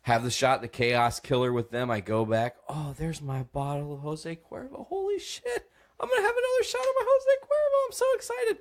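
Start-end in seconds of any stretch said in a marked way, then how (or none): 2.7-8.6 very high, fast, giggly squeal; drunk excitement. Retold or imitated. Drunk storyteller thrilled at his bottle, high giggling squeal. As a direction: gush like a drunk kid spotting candy. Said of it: "I've officially reached drunk".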